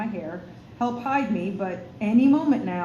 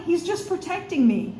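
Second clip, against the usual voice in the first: high urgent plea; pleading; 8 semitones higher than usual. Acted. Zara pleads with the girl to spare the robin. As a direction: beg her to understand.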